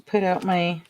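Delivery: usual speaking voice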